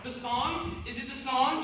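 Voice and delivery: excited. Acted. Brother eagerly asks about the song.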